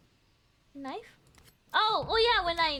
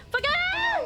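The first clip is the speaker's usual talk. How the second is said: panicked scream; 5 dB louder than usual. Real, not acted. Stuck while being chased.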